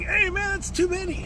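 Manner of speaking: high whiny mock complaint. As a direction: whine it like a spoiled worker. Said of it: "And we're whining".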